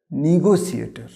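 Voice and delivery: "নেগোসিয়েটার" starting with "ni"; correct pronunciation. Shown as a demonstration. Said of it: "এর সঠিক উচ্চারণ হবে". ("নেগোসিয়েটার" সঠিক উচ্চারণে, নি-গো-সিয়েটা)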